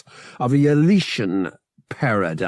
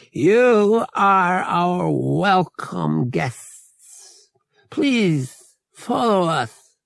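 slow, higher, louder formal creature voice; alien Togorian male; 7 semitones higher, 3 dB louder, much slower than usual. Acted. Murg welcomes Han and Bria as guests, slow higher creature voice. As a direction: welcome them slowly like a gracious alien host.

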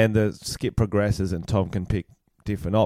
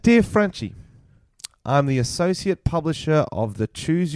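higher, measured read-aloud voice; formal email reading; 4 semitones higher than usual. Acted. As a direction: read out an official letter formally.

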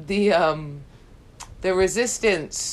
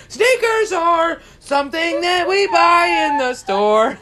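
higher, louder, slow and drawn out to drown out talk; mock loud cover-up voice; 10 semitones higher, 6 dB louder than usual. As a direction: talk over your friend, loud and slow. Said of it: "blanket your voice and be like".

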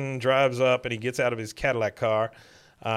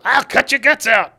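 higher, much louder threat; mock furious yell; 12 semitones higher, 10 dB louder than usual. Cotton charging the repo man with a saw, hammed-up furious yell. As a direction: scream it like a crazed old man.

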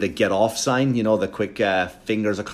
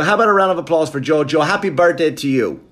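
higher, fast party host pitch; mock emcee voice; 5 semitones higher, 4 dB louder than usual. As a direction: hype the birthday like a cheesy MC. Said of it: "I go".

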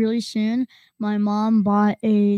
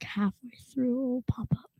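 soft whispered aside; whispering; 9 dB quieter than usual. Real, not acted. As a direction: drop to a hush. Joking aside about search.

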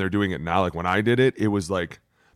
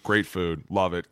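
usual speaking voice

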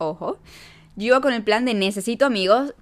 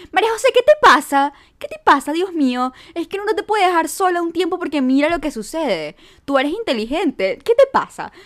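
scolding father; 7 semitones higher, 5 dB louder than usual. Retold or imitated. Dad scolding her about the date.

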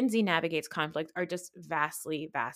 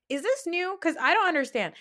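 high incredulous question; disbelieving; 10 semitones higher, 4 dB louder than usual. Real, not acted. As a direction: ask it in loud disbelief. Incredulous rant at cast.

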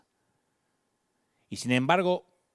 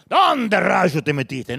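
slow, accusing demand, louder; accusatory; 7 dB louder, much slower than usual. Acted. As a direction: scold a hiding child. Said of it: "no creo que eso no acusatorio". (pregunta acusadora, lenta y más fuerte)

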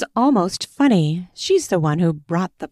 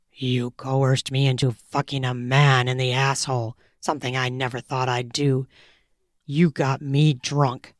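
dominant lover; 5 semitones lower than usual. A narrator's reading. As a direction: growl the order low and close. Male lover voiced low.

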